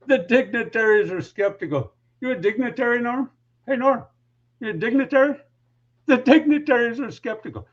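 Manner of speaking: high, sing-song mocking taunt. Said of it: "baiting them with this".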